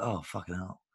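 muttered dismay. Acted. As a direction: curse under your breath, deflated. Diner's aside on being asked.

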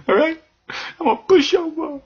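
very high, wheedling voice; mock whiny pushover. Host mocking himself as a pushover, high whine. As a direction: squeak it out like a pushover.

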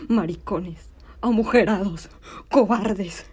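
furious stage denunciation; scornful insults. Acted. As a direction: hurl each word as a separate loud insult. Hurls insults at the cowardly men.

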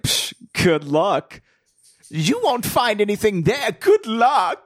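high, slow scoffing; mock old woman scoffing, high and drawn out; dismissive old lady. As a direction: brush them off like a cranky old woman. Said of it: "her reaction was just like".

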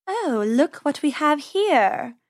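slow, purring predator voice; purring cat. Acted. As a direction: purr it like a lazy cat. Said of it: "she purred".

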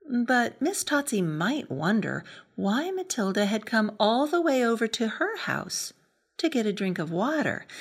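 usual speaking voice

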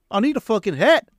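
a wild Scottish junkie from Trainspotting. Pretend. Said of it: "this is how I get my Scottish".

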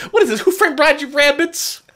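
put-on high excited kid voice. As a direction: laugh through an excited shout. Excited kid impression continued.

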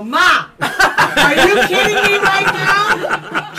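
loud, high, stretched outburst; slow incredulous holler; exasperated disbelief. Retold or imitated. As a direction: re-enact disbelief at a parent, nearly laughing. Exasperated at mother, acted.

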